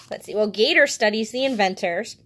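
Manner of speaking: plain reading voice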